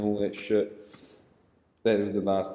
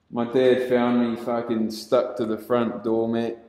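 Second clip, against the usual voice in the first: ordinary delivery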